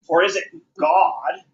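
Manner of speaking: usual speaking voice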